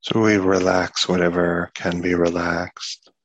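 unhurried meditation guidance with long pauses; guided meditation. Acted. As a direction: ease them into relaxing the body, one part at a time.

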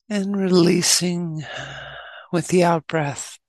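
measured meditation guide with long pauses; unhurried instructions, leaving long silences; guided meditation. Acted. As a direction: guide them gently into stillness, phrase by phrase.

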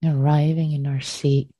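guided meditation. Acted. Teacher leading students to arrive and release, guided meditation. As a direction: guide them gently into the body, unhurried.

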